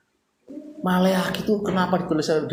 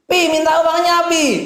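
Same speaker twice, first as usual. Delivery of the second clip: put-on much higher, louder wheedling child voice; whiny child; 12 semitones higher, 8 dB louder, slower than usual. Child pleading with Papa for money, high wheedle. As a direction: beg Papa like a spoiled kid. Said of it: "Betul nggak anak saya?".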